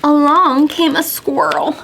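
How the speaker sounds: sing-song rhyme with gasping wind effects; counting rhyme for kids. Acted. Teacher reading a counting rhyme to children, sing-song. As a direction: read it like a children's counting game.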